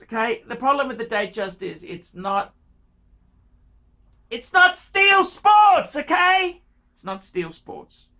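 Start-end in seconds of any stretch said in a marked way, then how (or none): 4.3-6.6 high, loud and slow, hammering the point; exasperated shout. Real, not acted. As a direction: yell it at him, fed up. Ranting about watch choice.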